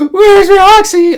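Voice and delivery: very high, loud, slow mock sobbing; mock crying. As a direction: wail it like a drunk crying girl. Imitating her crying.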